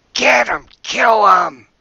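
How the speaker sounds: loud, high shout of an angry kobold; kobold yelling. Acted. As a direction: yell the order to attack at full voice. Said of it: "you hear him yell".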